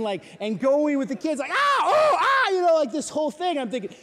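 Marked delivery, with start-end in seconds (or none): 1.5-2.5 high loud mock screams of a kid in a foam dart fight; imitated screaming. Youth leader mimics volunteer screaming in battle, loud mock shriek. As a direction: shriek it out like a kid under fire. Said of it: "He's screaming".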